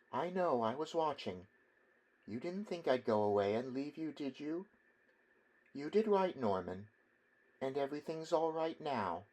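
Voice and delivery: high, soft, comforting mother voice; soothing mother. A narrator's reading. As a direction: hush him gently like a mother.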